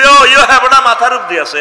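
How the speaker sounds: high, loud mocking of locals; mock local exclamation. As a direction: lampoon the careless local. Mimics locals pointing up loudly. (উঁচু গলায় ব্যঙ্গ করে নকল)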